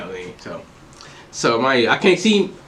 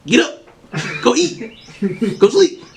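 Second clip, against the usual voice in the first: military orders; 9 semitones higher, slower than usual. Retold or imitated. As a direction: retell it like a drill sergeant's commands. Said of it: "I was like in the military".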